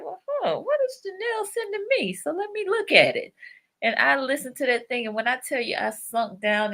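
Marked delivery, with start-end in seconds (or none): 0.0-3.3 much higher-pitched musing; high-voiced retelling of a curious reaction; curious retold thought. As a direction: say it high, as if wondering to yourself. Retells her own curious thought.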